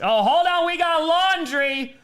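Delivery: loud, high excited call, stretched out slow; live play-by-play excitement over a penalty flag. Real, not acted. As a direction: shout it as the flag flies.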